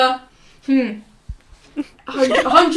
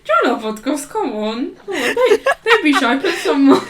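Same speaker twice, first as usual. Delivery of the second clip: laughing plea; 4 semitones higher, 3 dB louder than usual. Retold or imitated. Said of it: "I was like".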